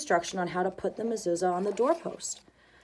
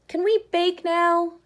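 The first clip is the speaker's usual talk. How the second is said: high, slow, eager ask; a child begging to bake, eager and drawn out; eager question; 8 semitones higher, 3 dB louder, slower than usual. A narrator's reading. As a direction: ask hopefully like a keen child. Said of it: "asked expectantly".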